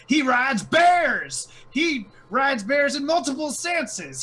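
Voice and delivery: very high, loud goblin-speech proclamation; high-pitched, full-volume harangue with drawn-out key words; York's rousing spiel. Acted. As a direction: shout it out like a herald rallying a rabble. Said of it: "jump right into his spiel".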